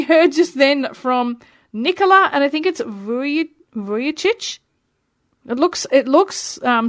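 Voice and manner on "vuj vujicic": tentative go at "Vujicic", questioning rise at the end; unsure pronunciation. Real, not acted. Radio host guessing at a Serbo-Croatian surname, unsure pronunciation. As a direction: stumble into "Vuj-, Vujicic?" as a hesitant guess. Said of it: "I've probably butchered it".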